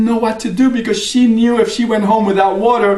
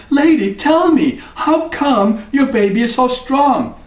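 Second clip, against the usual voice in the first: higher, slow pleading question; curious wife; 7 semitones higher than usual. Acted. Superman's wife asks the woman about the baby, higher and slow. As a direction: beg her to tell.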